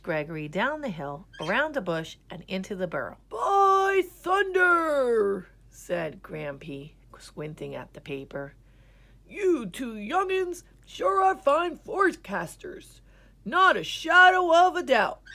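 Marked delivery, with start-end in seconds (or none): 3.3-5.5 put-on very slow, high, loud old-timer exclamation; old grandpa exclaiming. A narrator's reading. 9.3-15.2 put-on high, loud folksy old-timer; old grandpa voice. A narrator's reading. Grampy praising the twins.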